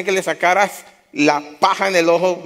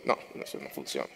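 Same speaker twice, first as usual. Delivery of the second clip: voice of God; 13 semitones lower, 13 dB quieter, faster than usual. Retold or imitated. God's reply, deep voice.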